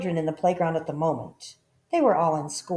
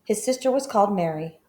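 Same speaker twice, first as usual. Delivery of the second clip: ordinary delivery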